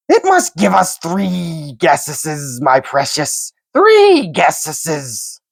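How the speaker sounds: slow, high creature bargaining; slow Gollum voice. A narrator's reading. Gollum slyly demanding three guesses from Bilbo. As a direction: drag the words out slyly.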